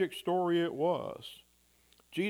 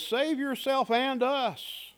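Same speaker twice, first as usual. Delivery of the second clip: much higher, slow mocking demand; tempter's taunt; 10 semitones higher, slower than usual. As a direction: sneer it like the tempter. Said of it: "the voice of the tempter".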